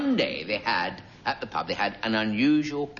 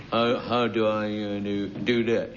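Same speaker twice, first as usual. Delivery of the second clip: a light, halting take on a slow-witted chap; impersonating the producer; 2 semitones lower than usual. Retold or imitated. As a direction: stumble through it like a bewildered new hire. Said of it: "that voice is a very lifelike impersonation".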